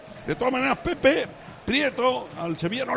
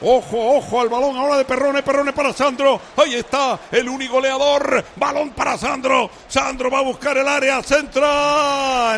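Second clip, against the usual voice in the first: play-by-play; 5 semitones higher, 5 dB louder than usual. Acted. Live radio match commentary.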